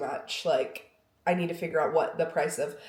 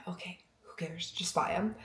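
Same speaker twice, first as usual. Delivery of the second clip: tired unvoiced whisper; weary whisper; 7 dB quieter than usual. Husband's weary reply voiced.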